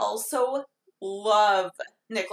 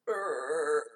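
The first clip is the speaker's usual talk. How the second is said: low zombie growl; zombie groan; 6 semitones lower, 5 dB quieter than usual. Pretend. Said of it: "That's a great zombie impression".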